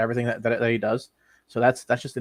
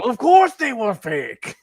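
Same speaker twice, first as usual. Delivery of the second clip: cartoon character voice; 9 semitones higher, 7 dB louder, slower than usual. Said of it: "Like, I go".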